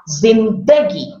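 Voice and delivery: zin-DA-gi, weight on DA; second-syllable stress. Shown as a demonstration. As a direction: punch the middle of "zindagi". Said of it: "stress on the second part".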